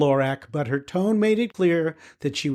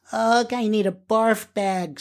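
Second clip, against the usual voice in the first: put-on queasy girl; 6 semitones higher, slower than usual. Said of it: "said Elo".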